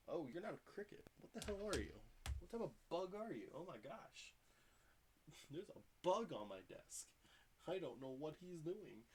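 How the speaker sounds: talking to a weird bug, bewildered; baffled at a bug. Real, not acted. Reacting to a strange bug.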